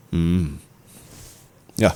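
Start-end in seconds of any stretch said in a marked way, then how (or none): none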